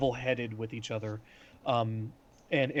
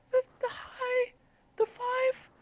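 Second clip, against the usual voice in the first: put-on tiny, very high, hesitant girl voice; high-pitched timid voice; slower than usual. Shown as a demonstration. Gnomish girl explaining to the council, high-pitched and timid. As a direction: squeak it out timidly, far above your own pitch. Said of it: "really high-pitched, timid voice".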